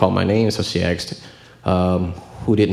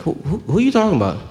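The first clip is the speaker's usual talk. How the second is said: high, fast, bewildered dad; the father baffled and quick, pitched well up; confused dad; 10 semitones higher, faster than usual. Retold or imitated. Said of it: "my dad is like".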